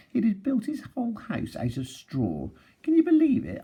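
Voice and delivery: amazed disbelief. A narrator's reading. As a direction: act floored by the idea. Wolf mocks pig's straw house.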